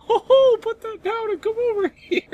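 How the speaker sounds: put-on very high falsetto girl voice; badly faked feminine falsetto. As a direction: squeak it out as a fake girl. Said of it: "talk like a girl".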